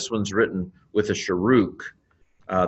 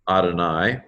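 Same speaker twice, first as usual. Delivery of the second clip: ordinary delivery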